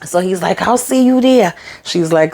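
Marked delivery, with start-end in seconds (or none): 0.6-1.5 high, pleased sign-off; eager. Retold or imitated. Gary happily telling his ex he'll see her, high. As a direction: sound thrilled to meet her.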